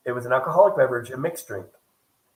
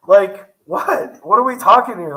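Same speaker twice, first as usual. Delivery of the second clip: exasperated outburst; 5 semitones higher, 9 dB louder, slower than usual. Real, not acted. Exasperated retelling of bar bill.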